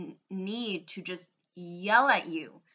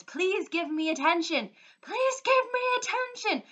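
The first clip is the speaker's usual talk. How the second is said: high, loud begging yell; mock screaming plea; 11 semitones higher, 3 dB louder than usual. Vlogger mimicking YouTubers screaming for attention, hammed-up high yell. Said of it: "they are screaming to the audience".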